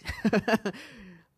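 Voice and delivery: bursting into a high laugh at herself; real laugh. Real, not acted. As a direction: laugh out loud at your own rambling. Laughs again after podcast remark.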